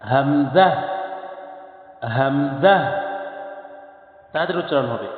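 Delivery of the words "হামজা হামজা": হামজা with a sharp catch on the start; hamza with a push. Shown as a demonstration. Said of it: "ধাক্কা দিয়ে পড়তে হবে". (ধাক্কা দিয়ে হামজা পড়া)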